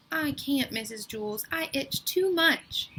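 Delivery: high whiny complaint, slowed at the end; Dana whining to her teacher about her itch. A narrator's reading. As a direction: plead like a miserable itchy kid. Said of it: "Dana whined".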